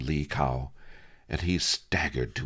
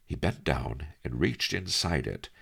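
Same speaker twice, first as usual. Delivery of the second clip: ordinary delivery, nothing marked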